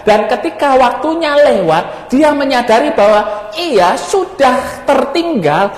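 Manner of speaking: high, urgent pulpit voice; impassioned preaching. Acted. Preacher warning about wasted time, impassioned.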